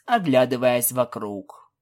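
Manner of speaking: usual speaking voice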